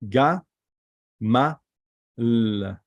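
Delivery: "Ga, Ma, L." as separate sounds with clear pauses; syllable by syllable. Shown as a demonstration. Teacher showing students how we pronounce it, syllable by syllable. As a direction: break it into "Ga", "Ma", then a held "L". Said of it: "So we pronounce it".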